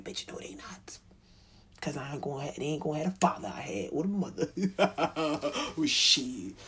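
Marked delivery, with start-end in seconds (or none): 0.0-1.0 whispered retort. Retold or imitated. Defiant reply to her mother.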